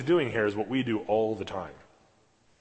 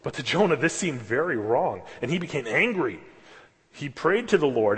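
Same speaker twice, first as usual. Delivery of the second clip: raised, fervent Bible reading; scripture reading; 7 semitones higher, 6 dB louder than usual. Acted. Preacher reading Jonah to the congregation, fervent. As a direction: read the passage with rising fervour.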